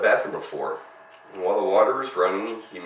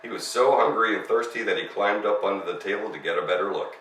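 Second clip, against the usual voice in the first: usual speaking voice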